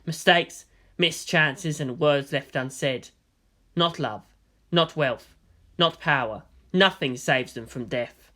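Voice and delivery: plain reading voice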